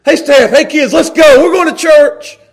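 loud, high, cheerful rounding-up; rally the family out the door; eager call to family. Retold or imitated. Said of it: "not hard for me to come here".

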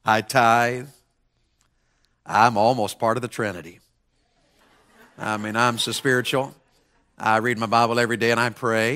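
mock the pious show-off, hushed and pleased; a lightly voiced self-righteous believer; smug self-satisfaction. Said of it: "We can think".

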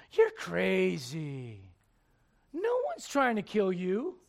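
very drawn-out words, swinging upward; mocking disbelief. Crowd dismissing Jesus's claim they'd kill him, mocking disbelief. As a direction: stretch it out in incredulous dismissal.

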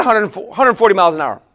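ordinary talk

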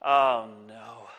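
low, sulky oh no; grumpy refusal. Acted. Servant grumbling at his boss, sulky low oh no. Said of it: "turn around and say".

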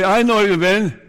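loud, high-pitched accusation; accusing. Acted. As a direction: throw it at them as an accusation. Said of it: "He did not accuse him. He was not mad".

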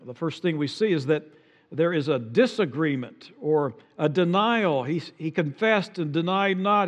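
usual speaking voice

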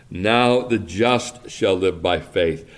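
"Now" hit hard up front, the line said slowly; stress on now. Shown as a demonstration. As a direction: open with a strong, weighty "Now". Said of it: "the emphasis is on this word now".

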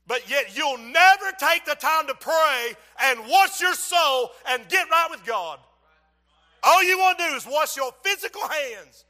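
high, loud, slow exhortation; loud, emphatic pulpit cadence; full-force preaching. Acted. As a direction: hammer the rebuke home.

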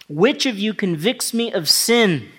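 high, loud, slow challenge; ringing, drawn-out preacher's challenge; challenging scripture quote. Acted. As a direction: throw the question out to the crowd.

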